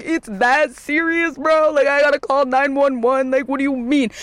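high-pitched incredulous protest; retold disbelief. Daughter told to call nine one one, retold disbelief.

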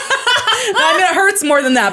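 high, loud, amused correction; laughing. Real, not acted. Podcaster correcting own joke to co-host, high loud laughing delivery. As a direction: laugh through the backtrack.

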